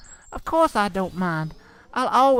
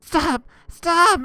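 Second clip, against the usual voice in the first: rising, louder panicked cries; terrified cry; 7 semitones higher, 7 dB louder than usual. Acted. Terrified bride crying out to her husband, rising panic. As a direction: cry out in terror as she backs away. Bride staggers back in horror.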